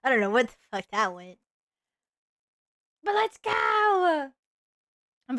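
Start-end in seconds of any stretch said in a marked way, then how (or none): 3.0-4.3 excited shout. Real, not acted. Hyped streamer cheer.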